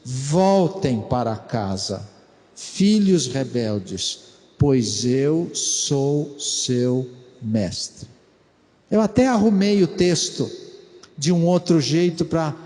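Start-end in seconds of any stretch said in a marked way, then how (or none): 0.0-8.1 low, slow, solemn divine call; voice of the Lord. Shown as a demonstration. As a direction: speak as God calling his children home. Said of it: "O Senhor diz".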